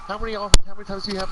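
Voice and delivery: fast, loud, high demand; loud quick question. Retold or imitated. The French chef grilling about fries, loud and fast. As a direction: fire the question at him. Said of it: "he's like".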